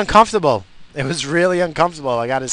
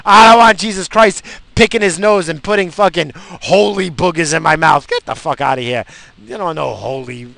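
raised, heated voice, half-joking indignation; mock angry retort; 4 semitones higher, 7 dB louder than usual. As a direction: rant back at grandma as if still furious. Retorting to grandma in church.

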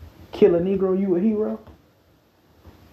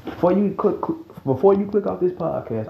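ordinary talk, nothing marked

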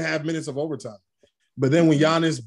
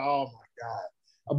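slow, quiet, lower groaning oh my God; weary disbelief; 4 semitones lower, 7 dB quieter, much slower than usual. Retold or imitated. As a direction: let it drag out in defeat. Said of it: "I was like".

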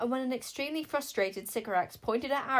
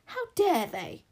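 outraged; 4 semitones higher, 6 dB louder, slower than usual. A narrator's reading.

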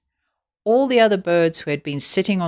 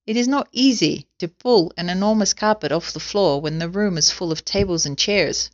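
usual speaking voice

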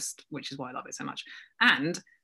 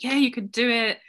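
higher-pitched supportive cheer; 8 semitones higher than usual. Acted. Community cheering a member on, encouraging. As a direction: cheer them on like a supportive friend. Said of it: "is just cheering you on".

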